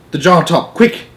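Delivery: high eager call for the lid; urgent. A narrator's reading. Giant asks Sophie for the jar top, urgent and high. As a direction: hurry her along.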